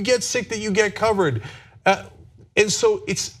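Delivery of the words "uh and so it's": ordinary delivery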